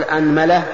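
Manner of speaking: "أنملة" said as anmala; opening a, middle a. Shown as a demonstration. Teacher lists variants for students, "أنملة" as anmala. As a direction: say "أنملة" with fatha on hamza and mim. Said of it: "افتح الهمزة".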